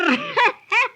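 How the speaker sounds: high gloating laugh; witch cackle. Acted. Witch laughing after the kidnap.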